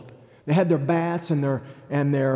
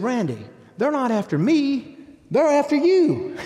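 Frank's urgent warning; 8 semitones higher, 3 dB louder than usual. Retold or imitated. Friend Frank warning Randy that the mob wants him: high, loud, laughing warning. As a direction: pitch jumps much higher and louder on "they're after you", with a laugh. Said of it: "Frank said".

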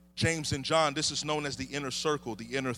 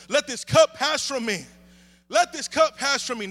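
high, loud repeated prayer; impassioned plea; 8 semitones higher, 8 dB louder than usual. Acted. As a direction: cry the plea up to God. Acts Jesus praying in agony.

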